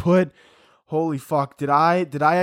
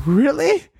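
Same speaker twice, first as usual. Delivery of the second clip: mock disbelief; 11 semitones higher, 6 dB louder than usual. Disbelief at agent.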